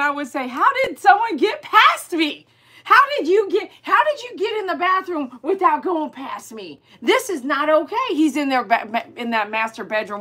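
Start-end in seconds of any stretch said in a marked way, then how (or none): 0.5-8.0 Mai Tai. Pretend. Speaking as the dog to whoever got past, indignant. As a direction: protest like an outraged guard dog. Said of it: "if I could be Mai Tai".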